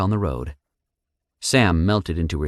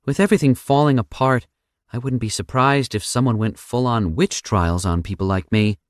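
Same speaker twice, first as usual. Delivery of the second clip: Sam voice; 6 semitones higher, 5 dB louder than usual. A narrator's reading.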